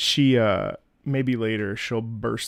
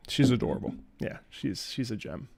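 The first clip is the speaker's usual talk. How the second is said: usual speaking voice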